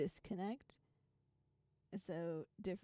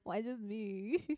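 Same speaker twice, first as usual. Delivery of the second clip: louder, playful mock whine of protest; half-laughing retold gripe, louder; mock complaint; 7 dB louder, much slower than usual. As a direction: toss it off like a comic grievance. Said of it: "And I was like".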